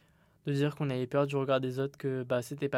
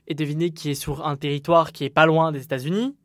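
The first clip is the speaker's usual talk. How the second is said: normal talking voice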